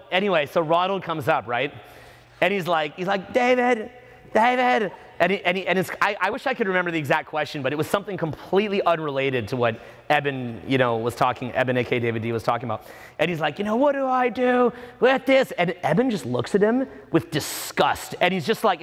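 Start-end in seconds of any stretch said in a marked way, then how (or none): 3.3-4.9 high, put-on call; heckler calling out. Imitated student calling the teacher. 13.6-15.5 high put-on student voice; mock clueless questioner. Imitated student asking his question.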